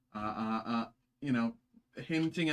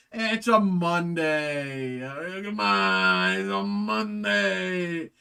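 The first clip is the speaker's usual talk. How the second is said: loud, higher, drawn-out wail; loud groan; 5 semitones higher, 7 dB louder, much slower than usual. Real, not acted. Vlogger excusing his card mix-up, loud slow whine. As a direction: whine it out slowly. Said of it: "It's Monday".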